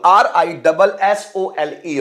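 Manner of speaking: slow, letter by letter; slow spelling. Shown as a demonstration. Teacher spelling rissole for students. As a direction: spell it out slowly. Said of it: "spelling aloud".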